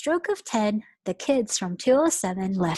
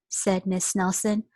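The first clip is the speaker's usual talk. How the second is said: ordinary delivery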